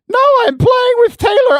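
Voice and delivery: put-on very high, loud little boy protest; yelling kid. Son refuses to leave the game, high mock yell. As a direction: imitate a small boy yelling back at his mom. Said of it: "And he yells".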